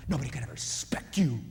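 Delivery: hissing whisper of contempt; whispered insult. Retold or imitated. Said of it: "when we say".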